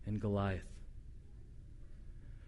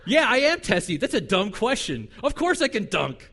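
testy; 5 semitones higher, 5 dB louder than usual. Retold or imitated. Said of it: "He's like".

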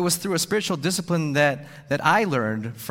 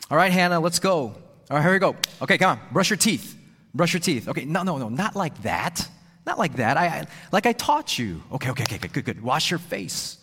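loud, higher, impatient stream of orders; hurried berating dad; 4 semitones higher, 3 dB louder than usual. Retold or imitated. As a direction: rush the kid to bed, barking orders. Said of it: "I berated her with directions and critiques".

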